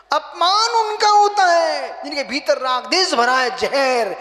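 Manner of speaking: loud, high, emphatic retort; forceful reply. Retold or imitated. Monk answers why he cannot be insulted, loud and forceful. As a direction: drive the point home loudly.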